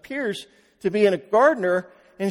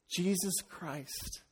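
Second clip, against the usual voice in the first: ordinary talk, nothing marked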